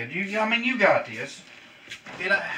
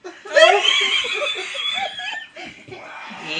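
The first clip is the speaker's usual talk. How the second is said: high laughter. Real, not acted. Laughing at friend's pepper reaction.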